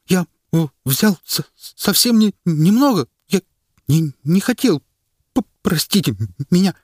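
high, stammering terrified plea; teeth-chattering stammer. A narrator's reading. Frightened official begging forgiveness, stammering. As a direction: stammer in fear. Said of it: "зачастил он, стуча зубами".

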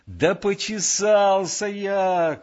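high, drawn-out shout; exasperated yell. A narrator's reading. Lieutenant explaining himself to the sentry, yelling. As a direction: yell it in wounded protest, stretching it. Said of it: "кричал Коля".

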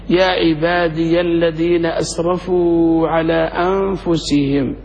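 يا عبادي الذين أسرفوا recited slowly, ya voiced before the hamza; slow recitation demo. Shown as a demonstration. Teacher reciting the verse slowly for students. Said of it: "تحركت لأجل الهمس".

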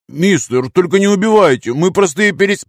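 high, loud plea for mercy; panicked pleading, cut off; frightened begging. Acted. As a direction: beg for your life, voice climbing. Man begging for his life.

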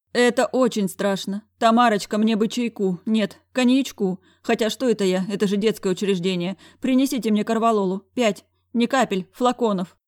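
a man at the end of his nerves; frazzled director. A narrator's reading. Director calls secretary in despair.

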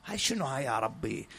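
ordinary talk

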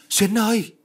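very high, drawn-out call. A narrator's reading. Mother running after her daughter, high calling out. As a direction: cry the name out high. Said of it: "Miệng kêu chân chạy".

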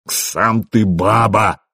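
slow, drawn-out angry retort; acted fury at moderate volume; furious retort. A narrator's reading. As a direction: stretch the insult out with rage. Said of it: "закричал Расмус яростно".

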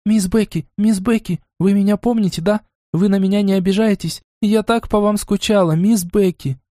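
rapid excited chatter; too much caffeine. A narrator's reading. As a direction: rattle it off, high and eager. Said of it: "Лайза затараторила". (тараторя, очень быстро и взволнованно)

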